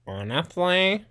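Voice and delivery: high, slow sarcastic echo; drawn-out mocking question. Mocking echo of clip's word.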